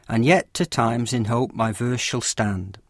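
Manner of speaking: slow, measured reading of the line with its iambic beat; slow recited Shakespeare line; reading iambic verse. Shown as a demonstration. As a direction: let each stress fall: And yet to times in hope my verse shall stand. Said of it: "Listen to the following line of poetry".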